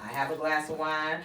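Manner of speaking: normal talking voice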